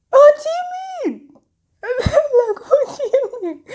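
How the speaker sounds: very high, loud squeal through laughter; laughing disbelief. Retold or imitated. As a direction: squeak out the quote while cracking up. Retold disbelief, helpless laughter.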